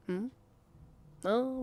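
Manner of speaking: ordinary delivery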